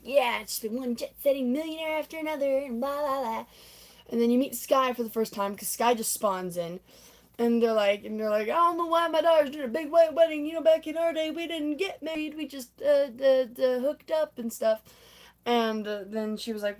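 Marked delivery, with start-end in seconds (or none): 0.0-3.4 lightly voiced jet-setting socialite, pitched up; Tanya bragging. Tanya tells old friends of her millionaires, lightly mock bragging. 8.5-14.8 higher-pitched character voice, mock bewildered; imitated complaining mother. Voices friends grumbling about daughter's wedding.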